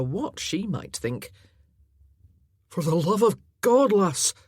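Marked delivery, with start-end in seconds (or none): 2.7-4.3 loud higher croak, voice cracking out. Acted. Laird calling up to the lass in the tree, loud croak. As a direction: croak it out in relief. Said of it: "he croaked".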